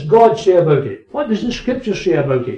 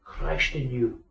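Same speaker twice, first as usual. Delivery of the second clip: hushed repeat; 2 semitones lower, 11 dB quieter, slower than usual. Acted. Repeated softly after loud quote.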